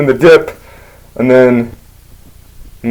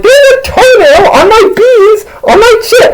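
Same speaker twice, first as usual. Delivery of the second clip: horrified friend. Retold or imitated. Guy finds a toenail on his beans, mock horrified. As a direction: wail it in hammed-up falsetto.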